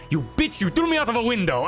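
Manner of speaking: high, fast, furious yell at him; furious accusation. Acted. Pyrrha yells at Jamin for throwing her out a window, furious. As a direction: spit it out at the man who threw her.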